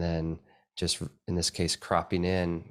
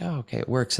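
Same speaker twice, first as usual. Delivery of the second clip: higher-pitched acted realization; dawning acceptance; 6 semitones higher than usual. Viewer coming round to a curious image, accepting. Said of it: "And then you're like".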